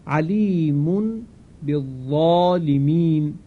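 slow Quranic chant, علیمون flowing into بالظالمین; slow tajweed reading; tajweed recitation. Shown as a demonstration. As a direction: recite slowly with the nasal m before ب. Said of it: "مثال اول".

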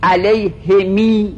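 علیهمی drawn out slowly, mim lengthened into a long i; lengthened final vowel. Shown as a demonstration. Teacher demonstrating ishba to students, slow and drawn out. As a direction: stretch the ending of علیهمی. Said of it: "کسر میم با اشباع".

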